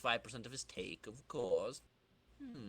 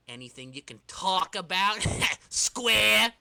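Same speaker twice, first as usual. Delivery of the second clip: bluff, higher, loud pal voice; hearty jock; 8 semitones higher, 5 dB louder, slower than usual. Acted. Flash cheering up his gloomy pal, hearty and loud. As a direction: josh him like a big buddy. Flash ribbing Peter, comic voice.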